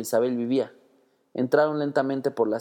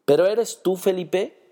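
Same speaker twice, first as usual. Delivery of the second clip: high, loud scared disbelief; frightened question; 6 semitones higher, 3 dB louder, slower than usual. A narrator's reading. Sister facing her lost brother, scared and unsure. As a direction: ask it half terrified. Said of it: "muerta de miedo".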